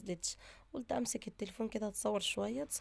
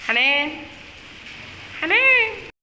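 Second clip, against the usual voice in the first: loud repeated call, last one higher; yelling a name; 3 semitones higher, 12 dB louder, slower than usual. Real, not acted. Impatient sister yells up for Hanan to come down. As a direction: shout her name up the building, rising.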